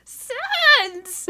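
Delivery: high, loud shouty skeleton yelp; Sans skeleton voice. Pretend. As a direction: blurt the name like a cartoon skeleton. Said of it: "that's the best I can do".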